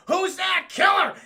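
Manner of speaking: high, loud shout to kill; shouted order. Retold or imitated. Sharon Stone orders her henchmen, loud shout. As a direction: bark it out like a villain boss. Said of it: "Sharon Stone goes".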